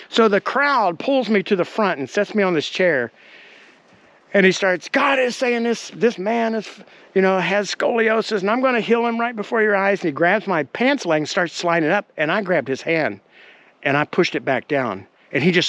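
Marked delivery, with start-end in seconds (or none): 4.9-10.0 raised-pitch showman preacher; lightly mocked revival proclamation; imitated faith healer. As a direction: announce a miracle to the crowd, mimicking the healer. Quoting healer addressing the crowd.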